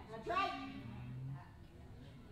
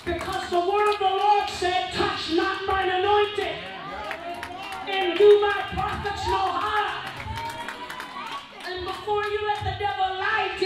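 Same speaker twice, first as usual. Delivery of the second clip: fiery preaching; 3 semitones higher, 6 dB louder than usual. Acted. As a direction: thunder the scripture at the doubters. Preaching at full force.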